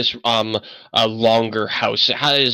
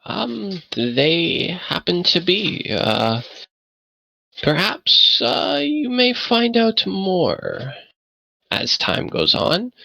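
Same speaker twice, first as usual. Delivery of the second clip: put-on lightly raised, hesitant old man's voice; a hint of a frail old man, unsure; old man NPC voice; 9 semitones higher, slower than usual. Game master voicing the old man to the party, hesitant.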